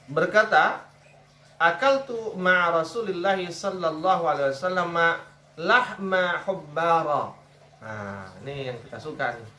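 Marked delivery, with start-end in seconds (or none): 0.1-7.3 slow, raised Arabic recital; Arabic recitation. Acted.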